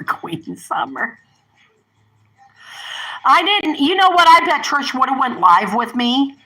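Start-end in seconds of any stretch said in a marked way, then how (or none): 0.0-1.2 soft, slow whispered nickname; hushed, drawn-out whisper of the nickname. Real, not acted. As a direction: breathe the name out fondly, slowly. Whispers the nickname after saying it.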